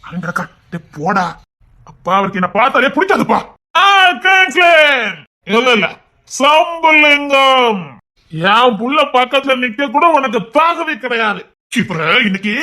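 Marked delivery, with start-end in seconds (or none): 3.8-4.7 high, loud call of his name; angry shout of the name; furious shout. Acted. 6.3-7.8 very slow, high, loud stretched name; mocking stretched shout; drawn-out shout.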